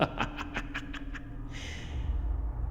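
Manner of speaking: soft breathy evil chuckle; low gloating chuckle. Acted. Killer chuckling over the hidden corpse, soft and breathy. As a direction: laugh quietly to yourself in triumph. Murderer relishes hiding the body.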